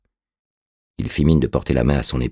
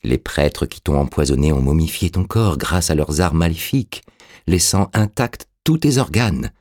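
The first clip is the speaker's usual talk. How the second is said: ordinary delivery, nothing marked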